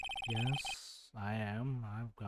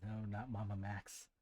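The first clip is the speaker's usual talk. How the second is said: hushed; 2 semitones lower, 6 dB quieter than usual. Acted. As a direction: hiss it under your breath. Hushed disgusted aside.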